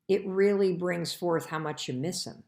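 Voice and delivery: ordinary delivery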